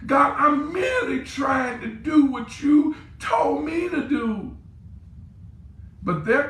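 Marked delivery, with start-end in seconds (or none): 0.1-4.6 much higher, loud slow shout; anguished shout. Acted. Frustrated pastor shouting his prayer to God.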